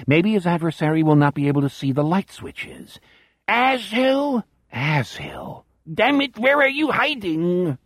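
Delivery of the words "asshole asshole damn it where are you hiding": put-on high, strange alien-German accent, frustrated; angry snarl in a mangled German accent; weird non-German accent. A narrator's reading. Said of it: "his accent melts into that weird non-German".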